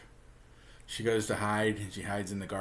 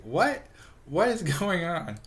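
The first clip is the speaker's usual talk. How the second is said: high, slow puzzled questions; bewildered; 8 semitones higher, slower than usual. Retold or imitated. Betty has no idea what's happening, bewildered. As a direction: imitate total bafflement, pitched right up.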